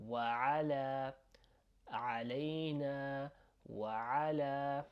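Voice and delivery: "وعلى" and "علينا" with long final a; measured demo, stretched endings on "علينا" and "وعلى"; lengthened final vowel. Shown as a demonstration. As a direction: hold the last vowel of "وعلى". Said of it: "it goes on just a little bit longer".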